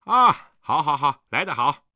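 loud, exclaiming welcome; 高声招呼. A narrator's reading. Host hails the cook after drinking. (大声招呼来人)

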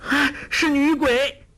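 very high-pitched cry of terror; shocked, shrieking exclamation; startled scream. A narrator's reading. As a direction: cry out in fright at a ghost. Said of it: "惊叫".

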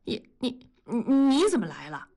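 startled stammer, stuck on the first word; flustered fright. A narrator's reading. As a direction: stumble nervously before getting the question out. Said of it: "同志吓得赶紧拉开抽屉".

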